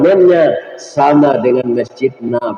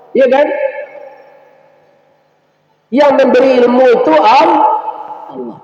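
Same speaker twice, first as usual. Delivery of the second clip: raised, higher preaching rebuke; preacher scolding doctors who demand deposits, raised and stern; 8 semitones higher than usual. Real, not acted. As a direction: scold the greedy doctors from the pulpit.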